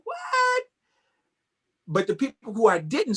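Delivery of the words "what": much higher, squeaky what of disbelief; stunned what. Retold or imitated. Dancer recalling shock at seeing his hero, high squeak. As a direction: squeak it out, astonished. Amazed Peg Leg Bass came.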